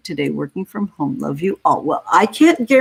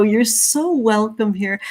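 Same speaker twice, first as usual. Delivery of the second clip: ordinary talk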